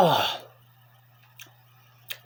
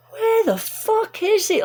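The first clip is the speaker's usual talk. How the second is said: frustrated yell; 13 semitones higher, slower than usual. Retold or imitated. Lost, frustrated in town.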